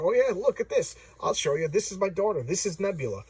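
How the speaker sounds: imitated eager boasting, pitch jumping up; mock show-and-tell enthusiasm. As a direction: act out someone keen to impress. Thanos shows off to Death.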